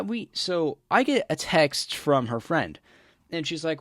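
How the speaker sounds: ordinary delivery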